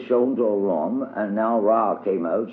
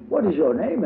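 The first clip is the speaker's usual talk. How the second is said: high little girl's voice, playing hostess; child voice for Diddy; 9 semitones higher than usual. A narrator's reading. Diddy greets her playmate at the door, high childlike voice. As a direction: lift into a small girl's prim voice.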